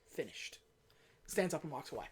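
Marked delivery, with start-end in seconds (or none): 0.1-0.6 very low and soft, one final word; grave finality. Retold or imitated. Agent stops the word test and walks away, quiet finality. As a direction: drop right down and close the subject quietly.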